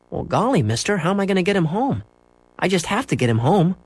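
high, earnest protest; pleading boy. A narrator's reading. Boy pleading with bus driver, dismayed. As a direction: appeal to the man in a high young voice.

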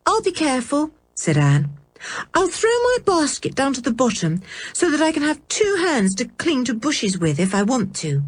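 put-on high girl's voice, light and quick; young girl reassuring. A narrator's reading. Anne answering her brother, high voice. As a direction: promise you'll take care.

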